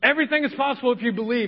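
rattled off fast like a slogan; fast fake-faith recital. Pastor mocking a father faking faith, fast rattled recital. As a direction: recite it quickly, pretending to believe. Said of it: "I'd start faking it".

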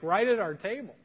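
ordinary delivery

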